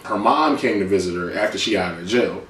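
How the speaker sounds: normal talking voice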